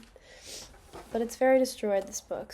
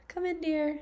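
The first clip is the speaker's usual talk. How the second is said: high sugary fake-polite voice; lightly voiced overly polite wolf; 8 semitones higher, 5 dB louder than usual. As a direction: coax her in sweetly, hiding the wolf. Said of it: "in his most polite voice".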